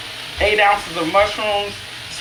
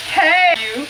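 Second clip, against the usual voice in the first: excited greeting; 10 semitones higher than usual. Real, not acted. Spotting a friend online.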